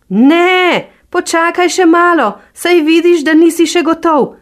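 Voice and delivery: high, loud taunting fox voice; teasing fox. Acted. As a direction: keep him there with mock patience. Fox telling bear wait.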